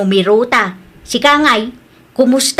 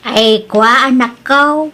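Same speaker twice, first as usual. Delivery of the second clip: normal talking voice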